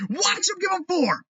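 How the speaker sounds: high, punchy restatement; angry repeat. Real, not acted. Fan repeating the Red Sox prediction, high angry restatement. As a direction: drive it home again.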